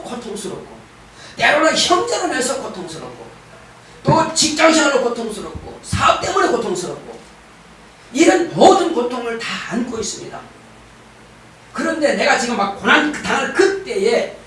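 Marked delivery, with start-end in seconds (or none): none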